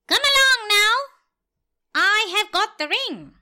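high, loud cat's call. Acted. Cat calling to the dog, high and loud.

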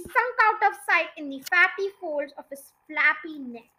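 ordinary delivery, nothing marked

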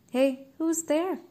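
slow suspicious call upward. Acted. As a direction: call out warily, drawn out. Calling up at the house.

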